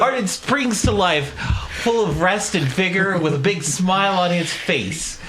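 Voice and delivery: high, slow, drawn-out narration; buoyant, stretched-out description of waking; gleeful vigor. Acted. Describes character waking full of vigor.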